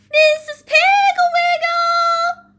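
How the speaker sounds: long, high, drawn-out holler; calling out. Acted. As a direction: holler the name slowly across a yard. Child calls from back porch.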